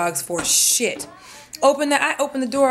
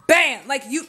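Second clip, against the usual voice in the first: ordinary talk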